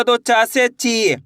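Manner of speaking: very slow, with ची held long; slow demo. Shown as a demonstration. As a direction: stretch each syllable for beginners. Teacher demonstrating syllable change. (बहुत धीरे, ची को खींचकर)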